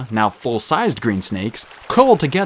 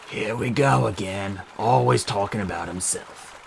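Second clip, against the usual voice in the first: grumbling snake; 5 dB quieter, slower than usual. Acted. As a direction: mutter it with a sigh.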